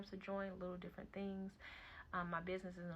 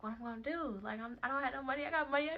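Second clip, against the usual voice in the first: high, raised, urgent pleading; retold frantic self-justifying; worked-up protest; 7 semitones higher, 5 dB louder than usual. Mother fretting over money and her son, worked up.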